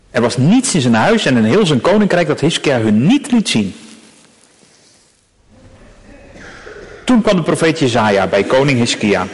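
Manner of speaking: measured, solemn reading of a Bible passage; scripture reading. Acted. Preacher reading scripture aloud to the congregation, solemn and measured. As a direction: read the text to the congregation with gravity.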